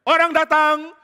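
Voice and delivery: loud high alarm call; guard's shout. Acted. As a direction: call out a warning from the wall. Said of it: "pasukan penjaga langsung teriak". (teriakan penjaga yang keras)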